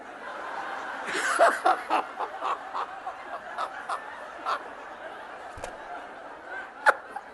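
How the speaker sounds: high airy laughter that keeps rising; helpless breathless laughter; long real laugh. Real, not acted. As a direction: lose it completely at the punchline. Cracks up at punchline.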